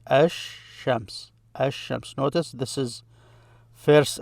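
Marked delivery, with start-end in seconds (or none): 0.1-2.1 ash-shams with a long sh replacing the L; assimilated sh. Shown as a demonstration. Teacher showing how it comes out, ash-shams with doubled sh. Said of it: "it comes out like this".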